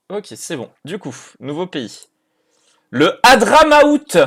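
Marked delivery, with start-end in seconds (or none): 2.9-4.2 high, slow, booming-loud reveal; loud announcement. Real, not acted. Streamer revealing the new country, loud drawn-out announcement. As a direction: proclaim it, stretched out and loud.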